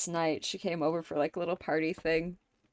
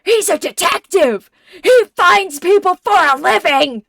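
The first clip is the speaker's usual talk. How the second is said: furious, much higher and much louder outburst; pissed-off Riddler; 10 semitones higher, 15 dB louder than usual. Retold or imitated. Riddler yelling at Ed for forgetting, furious shout. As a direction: explode at Ed's stupidity as the Riddler. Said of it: "Riddler getting so immediately pissed off".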